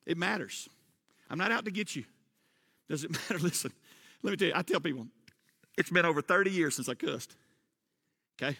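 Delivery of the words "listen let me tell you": normal talking voice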